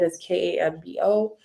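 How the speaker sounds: "K A M B O" letter by letter; spelling "K A M B O" letter by letter for viewers. Shown as a demonstration. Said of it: "that's how you spell it".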